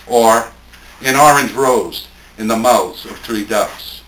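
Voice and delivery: unhurried, weighty reading of a poem. Acted. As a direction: give each phrase room, like an elegy. Reads untitled poem aloud.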